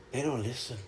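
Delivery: low, hushed imitation of dad; retold resigned aside. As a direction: say it softly, giving up on them. Dad's resigned answer, retold.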